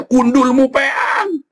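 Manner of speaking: high, drawn-out yell; shouted Javanese jibe, stretched out; blunt shouted retort. Shown as a demonstration. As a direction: holler it long and high as a blunt insult. Blunt retort versus soft-spoken hypocrites.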